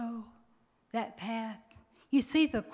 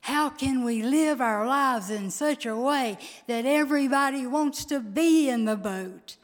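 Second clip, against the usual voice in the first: higher, louder preaching at full force; rally the congregation, voice rising; building sermon climax; 4 semitones higher, 5 dB louder than usual. Acted. Preaches the closing call.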